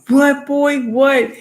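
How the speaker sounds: high, loud gloating shout; triumphant taunt. Retold or imitated. Ravens fan answering the Saints fan after a touchdown, shouting. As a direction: throw it back in his face. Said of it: "I was like".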